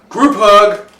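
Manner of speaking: excited shout. Real, not acted. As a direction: gasp then cheerful yell. Excited call joining the hug.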